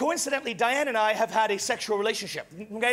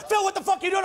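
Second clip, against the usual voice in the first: retold yell; 6 semitones higher, faster than usual. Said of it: "The drug dealer yells out".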